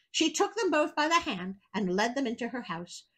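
ordinary delivery

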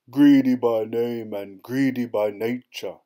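bellowing giant. A narrator's reading. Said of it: "he bellowed".

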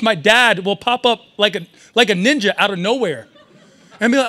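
normal talking voice

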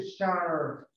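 shark drawn out slowly on an American R; American, pronounced R. Shown as a demonstration. Teacher demonstrates American for pupils, shark with a long R. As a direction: stretch the curled R in shark. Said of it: "le pone una R ahí".

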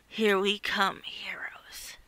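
whispered villain threat; menacing whisper. Acted. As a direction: hiss it quietly to the heroes.

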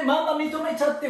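ordinary talk, nothing marked

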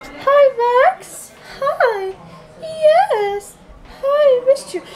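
high squeaky voice for a dog. Real, not acted. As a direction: coo at your puppy, way up high. Greeting her dog.

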